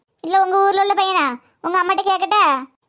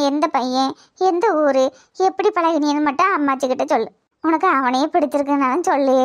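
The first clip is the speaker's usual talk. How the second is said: gentle grandmother; 5 semitones lower than usual. Acted. Grandmother voice, reassuring. (மென்மையான பாட்டி குரல், தாழ்ந்த தொனியில்)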